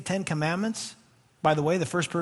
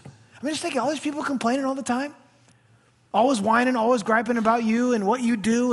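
higher, whiny griping about the people; mock complaining; 6 semitones higher than usual. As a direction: sound fed up with their nonstop whining. Mimics people always complaining.

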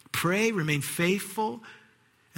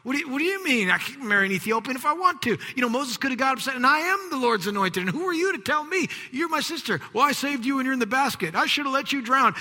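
high, louder indignant comeback; mock-outraged brother; indignant retort; 10 semitones higher, 5 dB louder than usual. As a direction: snap back at his sister. Moses voiced retorting angrily at sister.

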